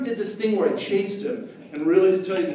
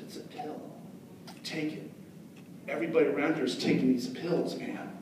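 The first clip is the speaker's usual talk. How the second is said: whispered, much softer pitch; conspiratorial whisper; 6 dB quieter than usual. Retold or imitated. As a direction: hush it like a dealer. Pushing fake drug quietly.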